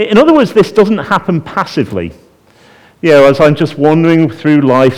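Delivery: ordinary talk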